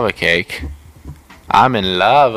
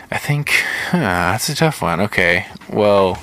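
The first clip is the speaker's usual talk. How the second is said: normal talking voice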